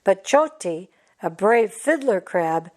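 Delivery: ordinary delivery, nothing marked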